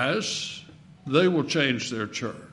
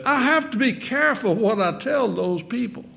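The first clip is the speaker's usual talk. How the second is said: high nasal preacher voice; high, nasal old-preacher impression; R G Lee; 8 semitones higher than usual. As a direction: keep the imitated voice pitched high and nasal. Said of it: "in that high nasal voice".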